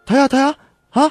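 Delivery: high, loud amazed cry; a burst of delight; excited exclaim. Acted. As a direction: point at the glowing bugs in wonder. Amazed by fireflies.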